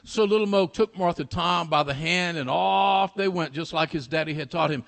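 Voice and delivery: ordinary delivery, nothing marked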